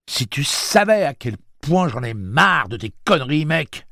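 voix très haute et forte, exaspérée; colère. Acted. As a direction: rugit sa rage. Eddie furieux, arme en main. (Eddie menace Roland, furieux)

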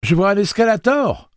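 high, loud cry of discovery; thrilled high-pitched shout; excited shout. Acted. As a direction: call out over the alarm. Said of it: "cria Susanna".

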